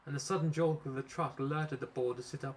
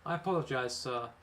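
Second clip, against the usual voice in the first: plain reading voice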